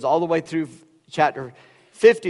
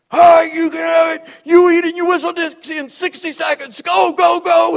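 barked, high and loud military order; drill sergeant shout; 14 semitones higher, 7 dB louder than usual. Retold or imitated. Drill instructor yelling at a hungry freshman cadet, loud bark.

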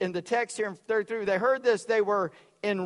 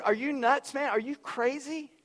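higher-pitched, mildly incredulous scolding; lightly acted incredulous thief; 5 semitones higher than usual. Other thief rebuking his fellow, mildly incredulous. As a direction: challenge him as if he's lost his mind. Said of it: "he said".

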